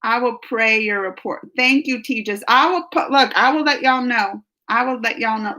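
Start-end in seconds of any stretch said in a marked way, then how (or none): none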